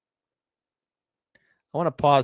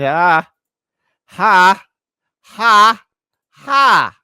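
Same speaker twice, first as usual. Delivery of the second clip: loud, separate "ha"s climbing higher; mock laugh; 7 semitones higher, 13 dB louder, much slower than usual. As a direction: belt out a staged laugh, rising each time.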